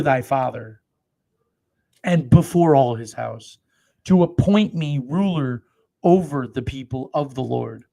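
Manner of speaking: slow, loud, weighty Bible reading; slow thundering scripture read; solemn scripture reading. Acted. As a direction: proclaim each phrase slowly like holy writ. Reads David's reply aloud.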